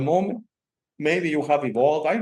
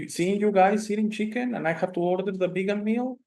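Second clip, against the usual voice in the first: upset retort; 6 semitones higher than usual. Retold or imitated. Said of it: "and she was upset".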